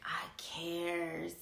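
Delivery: whispered, mocking the fake words. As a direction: breathe it out with scorn. Mocking fake caring phrases.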